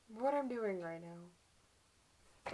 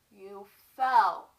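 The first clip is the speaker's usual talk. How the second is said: "fell" hit much louder; husband's firm verdict; 19 dB louder than usual. Acted. Acts husband's menacing cover story.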